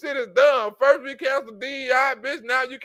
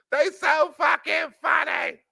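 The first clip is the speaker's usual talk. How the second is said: laughing shout; 4 semitones higher, slower than usual. Real, not acted. Repeated remark shouted.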